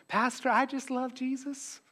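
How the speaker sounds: high, put-on Southern churchgoer voice; Southern accent. Masked churchgoer gushing to the pastor, mock Southern accent. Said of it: "I don't know why that's a Southern accent".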